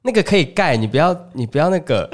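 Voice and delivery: mock mom begging her not to give it away; protesting mom.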